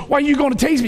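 mock defiant woman. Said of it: "She's cussing this cop".

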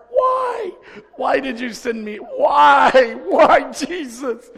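loud, high, pleading why, ending in a laugh; exasperated outburst. Retold or imitated. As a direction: blow up in mock disbelief at the phone. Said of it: "I'm like".

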